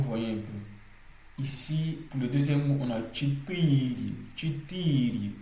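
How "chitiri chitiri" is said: chitiri with the middle "ti" held long; stressed second syllable. Shown as a demonstration. Teacher showing learners where stress falls, chitiri drawn out on "ti". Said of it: "Vous voyez comment j'insiste là-dessus".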